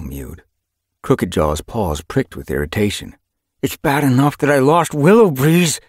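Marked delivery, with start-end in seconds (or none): 3.6-5.8 growling. A narrator's reading. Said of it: "he growled".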